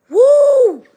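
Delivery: high, loud whoop; excited woo. Real, not acted. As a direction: let out a big excited woo. Recalling heated debates.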